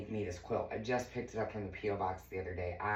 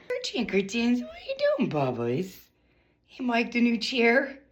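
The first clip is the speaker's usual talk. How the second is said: much higher, sing-song pet voice; 13 semitones higher, 7 dB louder than usual. Real, not acted. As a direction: owner cooing at her cat in a high voice. Talking to her cat.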